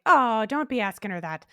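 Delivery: loud, hammed-up bad Irish accent; terrible Irish accent. Bartender scolding the guy for asking her, in a bad loud Irish accent. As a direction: play the bartender in a clumsy Irish brogue. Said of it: "that's my terrible Irish accent".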